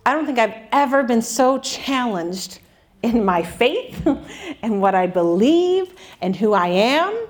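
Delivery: ordinary delivery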